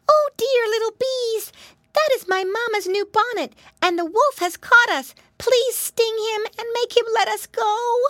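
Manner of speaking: very high, loud, urgent plea; piglet crying out. A narrator's reading. Captured Pinky begs the bees for help, crying out. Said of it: "she cried out".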